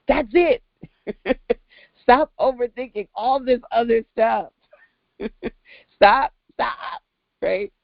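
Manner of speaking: high, laughing scold to stop overthinking; laughing exhortation. Real, not acted. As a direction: laugh while telling them to stop. Laughing, urging clients stop overthinking.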